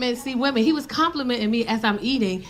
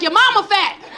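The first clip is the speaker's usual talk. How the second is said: higher and louder playground insult; mock angry comeback; 8 semitones higher, 4 dB louder than usual. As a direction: throw the insult back hard. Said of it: "I was like".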